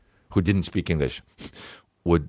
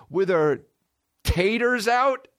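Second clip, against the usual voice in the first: slow, high disbelief; incredulous; 13 semitones higher, slower than usual. Real, not acted. Comic reacting to the cleaning ad, high and slow. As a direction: stretch it out in disbelief. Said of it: "incredulous mock outrage".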